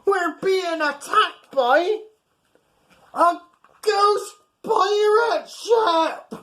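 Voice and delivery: high, loud, slowing panicked shout; terrified shout. Acted. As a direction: scream the alarm to the crew. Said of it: "yelled Craddock".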